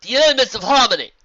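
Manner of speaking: high, loud proclamation; portentous zebra. Acted. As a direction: announce it with grand weight. Zebra announces great magic.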